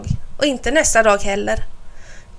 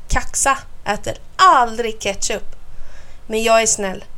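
usual speaking voice

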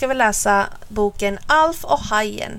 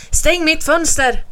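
higher, slow, insistent; urgent order; 6 semitones higher, 4 dB louder, slower than usual. A narrator's reading. Alf wants the window shut against water, urgent order. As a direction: give a pressing order in mild alarm.

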